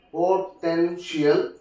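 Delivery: "potential" said slowly, syllable by syllable; broken into syllables. Shown as a demonstration. Teacher showing the stressed syllable, "potential" slowly broken up. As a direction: stretch "potential" out in separate syllables. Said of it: "the second syllable it takes".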